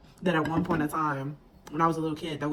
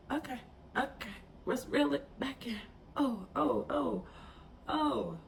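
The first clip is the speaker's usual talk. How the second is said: pulling herself together; 7 semitones higher, 6 dB quieter than usual. Real, not acted. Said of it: "I didn't expect to get emotional".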